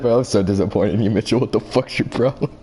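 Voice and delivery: low, stern dad voice; a hint of a disappointed father; lightly voiced disappointed dad. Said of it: "His dad, his dad".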